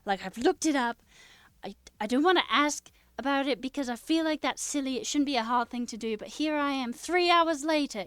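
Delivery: loud, exasperated retold rant about the database; frustrated rant. Streamer retelling her first day to chat, loud exasperated rant. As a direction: vent like you want to strangle the computer. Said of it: "I was like".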